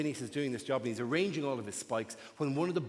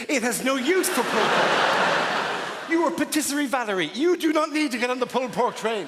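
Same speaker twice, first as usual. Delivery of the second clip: louder, higher mock scolding; mock outrage; 6 semitones higher, 7 dB louder, faster than usual. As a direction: scold the cake shop with hammed-up outrage. Scolding a cheesecake shop.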